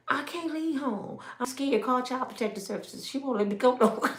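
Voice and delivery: soft, whiny fake fear; mock husband too scared of his wife; mock scared man. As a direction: whimper like a trapped husband.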